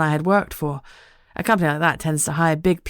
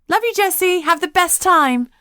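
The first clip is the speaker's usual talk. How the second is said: a touch higher, playful best friend teasing; lightly voiced Lucy; 13 semitones higher, 5 dB louder than usual. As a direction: tease her friend in a light, bright voice. Lucy saying goodbye.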